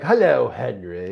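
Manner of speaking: slow, urbane butler greeting; a polite, unhurried British butler. Retold or imitated. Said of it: "he speaks almost like a British butler".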